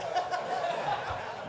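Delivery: high breathy giggle; a cheeky laugh at yourself; light self-mocking laughter; amused laugh. Real, not acted. Laughs at own capitalism.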